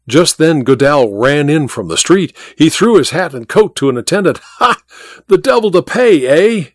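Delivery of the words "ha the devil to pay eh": high-pitched, agitated exclamation; excited cry. A narrator's reading. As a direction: burst in with excited news. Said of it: "he cried excitedly".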